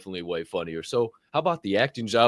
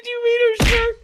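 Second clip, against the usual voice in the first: very high, squealing near-crying laughter voice; hysterical disbelief; 9 dB louder, slower than usual. Real, not acted. Co-host cracking up over a woman's shirt, hysterical squeal. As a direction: squeal it through helpless laughter.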